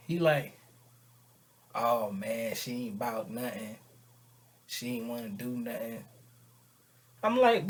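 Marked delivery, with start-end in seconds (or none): 1.7-6.0 low, soft grumble of disappointment; let-down. Retold or imitated. Friend telling how it went with her, let-down. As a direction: sink down and give up on her.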